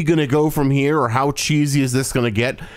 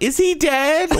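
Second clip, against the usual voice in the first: mock Lisa; 13 semitones higher than usual. Mocking Lisa's line.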